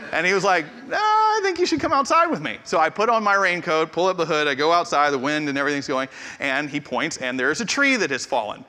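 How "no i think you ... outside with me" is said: insistent neighbor. Retold or imitated.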